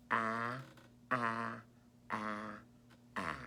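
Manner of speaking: "a" repeated as a short, clear a; repeated a sound. Shown as a demonstration. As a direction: say "a" four times evenly. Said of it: "working on the sound a".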